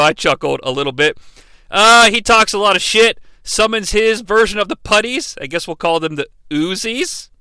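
ordinary talk, nothing marked

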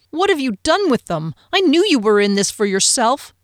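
furious accusing elf. Acted. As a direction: accuse him to his face. Fennel accuses Frederick.

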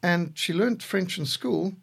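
normal talking voice

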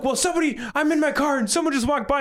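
very high-pitched defensive imitation; hammed-up panicky protest; mock flustered excuse.

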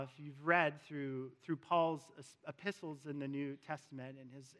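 ordinary talk

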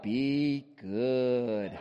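low mock Darth Vader voice; a low, menacing Darth Vader impression; deep Vader voice. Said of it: "I'm like".

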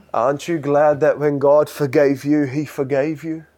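ordinary delivery

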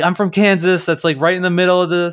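mock-earnest tourist, talking fast; eager self-quote. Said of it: "and I'm like".

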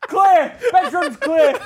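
very loud, high-pitched room-clearing shout; officer calling out. Retold or imitated. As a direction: yell it down from upstairs, mock police. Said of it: "all I can hear from upstairs".

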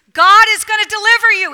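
loud, high rousing proclamation; proclaiming Moses. Acted. Moses proclaiming deliverance to the Hebrews, loud and rousing. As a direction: shout the promise to the crowd.

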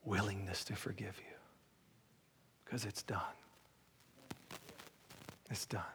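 hushed whisper of assurance. Acted. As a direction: breathe it out in a whisper. Hushed climax of altar appeal.

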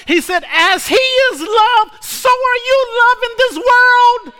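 high, shouted sermon climax; full-force preaching. Acted. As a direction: whoop and cry it out to the congregation.

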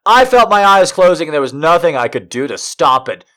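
ordinary delivery

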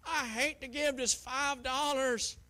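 high, loud whiny complaint; mock grudging giver. Preacher mocking a grudging giver, loud and whiny. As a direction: whine like a stingy churchgoer. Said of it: "Not grudgingly, like".

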